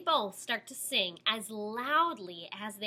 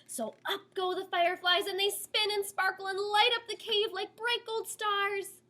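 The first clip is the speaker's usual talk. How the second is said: plain reading voice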